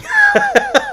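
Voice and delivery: high, loud embarrassed giggle; real high-pitched laugh; burst of laughter. Real, not acted. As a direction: crack up at yourself. Cracks up attempting the accent live.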